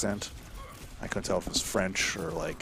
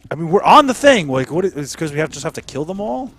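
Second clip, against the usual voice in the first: loud, much higher frustrated yelling; loud outraged complaint; angry gamer rant; 8 semitones higher, 15 dB louder than usual. Real, not acted. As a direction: rage at the unfair match. Angry at game loss.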